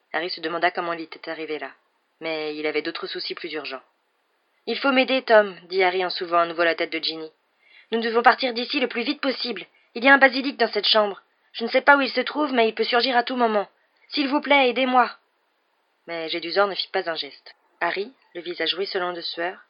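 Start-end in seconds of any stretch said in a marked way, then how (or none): 4.7-15.1 high, loud, urgent begging; frantic plea for help; urgent pleading. A narrator's reading. Harry urgently begs for help.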